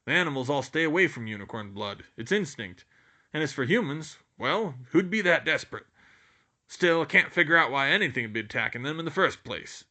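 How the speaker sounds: a hint of the booming giant; lightly voiced Hagrid. A narrator's reading.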